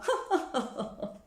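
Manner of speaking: high self-mocking laugh; laughing at herself. Real, not acted. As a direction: burst of laughter at your own naivety. Laughs at her own past resolve.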